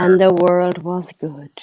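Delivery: slow whispered storyteller line; whispered narration. Acted. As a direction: whisper it like a fable ending. Mock grand closing line.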